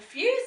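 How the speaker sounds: high loud sigh of relief; high mock relieved phew. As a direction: breathe out in relief. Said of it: "said the dog".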